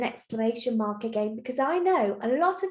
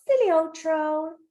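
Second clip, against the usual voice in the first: higher, teasing goat voice; gloating little goat; 4 semitones higher, 3 dB louder than usual. Acted. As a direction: tease the troll lightly. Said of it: "laughs to himself".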